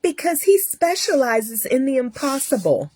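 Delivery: high-pitched imitation of a nurse. Nurse praising the surgeon to a patient, high put-on voice. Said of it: "She's like".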